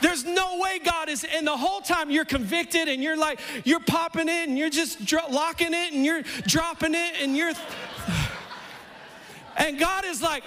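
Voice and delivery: fiery pulpit crescendo; full-force preaching. Acted.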